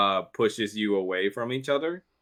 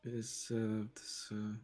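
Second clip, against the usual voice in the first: creepy butler; 2 semitones higher, 9 dB quieter, slower than usual. Acted. GM voices maid serving dinner.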